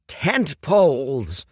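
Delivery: a fussy alchemist cursing his tent poles; indignant cry. Acted. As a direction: shake a fist at the tent poles. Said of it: "he cried".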